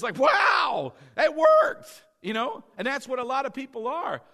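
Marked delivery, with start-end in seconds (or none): 0.2-2.0 high, loud imitated amazement; shocked. Healed young man calling the pastor, shocked amazement. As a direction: blurt it out as if stunned. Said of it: "but he was shocked".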